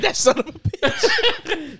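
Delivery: high, slow, laughing insult; drawn-out laughing mock curse. Friend mimics the movie line, slow and laughing. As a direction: stretch it out while cracking up. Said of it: "You said".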